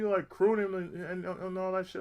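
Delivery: ordinary delivery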